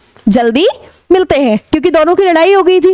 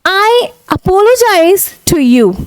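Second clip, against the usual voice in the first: very slow, careful apology; slow demo; 4 semitones higher, much slower than usual. Shown as a demonstration. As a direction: stretch each word out. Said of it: "word को याद रख लें".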